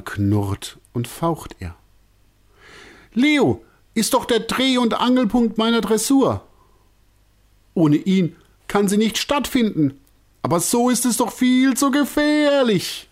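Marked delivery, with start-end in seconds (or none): 3.1-13.1 high, agitated explaining; completely flustered. A narrator's reading. Director explaining to circus folk, high and flustered. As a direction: babble it in high confusion. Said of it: "erklärt Herr Alasi völlig durcheinander".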